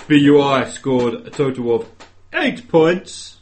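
slow score announcement building to loud; announcer. Acted. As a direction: announce it grandly, pausing before each number.

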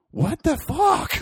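very high, slow baffled squeak; high, drawn-out squeak sitting in underwear, confused by the apology. Retold or imitated. Imitating own baffled reaction.

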